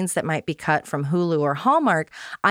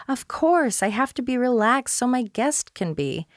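higher-pitched, strained brightness, a Southern hostess forcing calm; forced agreement; 4 semitones higher than usual. Retold or imitated. Blanche agreeing with Dorothy, in a tight, high-pitched, forced voice. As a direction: insist you're relaxed in a tight, high voice. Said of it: "as she agrees with Dorothy".

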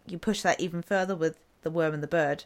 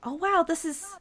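a much higher, wondering oh wow; amazed wow; 10 semitones higher than usual. Retold or imitated. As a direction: gasp it out in delighted awe, high up. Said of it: "I looked at it and went".

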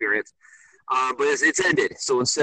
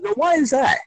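mock incredulous retort. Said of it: "I said".

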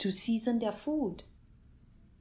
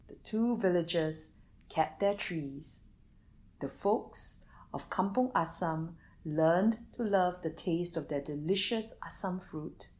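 ordinary delivery

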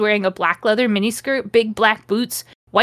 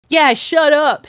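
preppy; 5 semitones higher, 5 dB louder, slower than usual. Acted. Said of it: "Snake said preppily".

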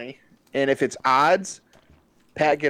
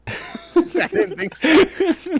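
laughing; 6 dB louder, much slower than usual. Real, not acted. Laughs at friend's joke.

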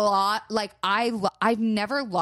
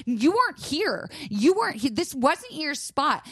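high, slow indignant mock; whiny entitled mock voice; snotty woman; 8 semitones higher than usual. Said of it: "the audacity to be like".

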